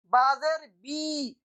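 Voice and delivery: बाजेर बी slow, ending in a clear long ee; बी with ee, not be; slow demonstration बाजेर बी; zer read as ee. Shown as a demonstration. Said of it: "बे नहीं कहेंगे".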